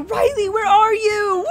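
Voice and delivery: high, slow, drawn-out wail; panicking. Acted. Big sister calling for her missing sister, panicking. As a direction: call out in hammed-up distress. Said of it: "I'm panicking".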